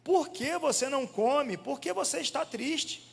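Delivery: slow, high, gentle husband asking why she weeps; tender questioning. Retold or imitated. Husband Elkanah gently asking Ana why she cries, slow and high. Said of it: "seu marido, lhe perguntava".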